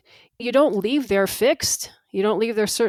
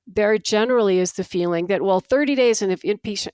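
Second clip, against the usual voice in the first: normal talking voice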